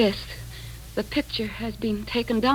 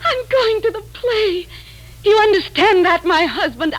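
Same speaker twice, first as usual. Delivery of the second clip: overjoyed; 9 semitones higher, 3 dB louder than usual. Acted. Wife delighted about theater trip.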